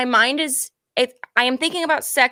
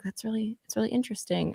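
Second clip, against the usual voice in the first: muttered thought; 11 dB quieter than usual. Retold or imitated. Quoting own thought, mumbled.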